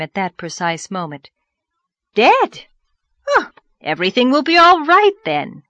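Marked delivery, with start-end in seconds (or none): 2.1-5.6 high, loud, delighted exclamation; jubilant cry. A narrator's reading. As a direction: burst out in jubilation. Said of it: "cried the rosy lady jubilantly".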